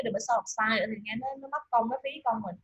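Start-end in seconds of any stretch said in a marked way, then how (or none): none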